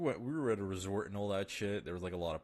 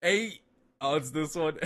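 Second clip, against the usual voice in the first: excited shout; 7 semitones higher, 7 dB louder than usual. Real, not acted. Reacting to a notification.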